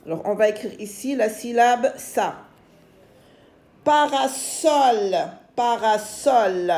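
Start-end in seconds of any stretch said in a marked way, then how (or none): 3.8-5.2 "Parasol" slowly split into long syllables. Shown as a demonstration. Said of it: "On va écrire la syllabe so". 5.5-6.7 "Parasol" slowly sounded out in beats; syllable by syllable. Shown as a demonstration. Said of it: "On va écrire la syllabe so".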